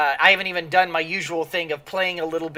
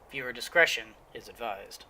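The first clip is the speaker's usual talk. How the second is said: ordinary talk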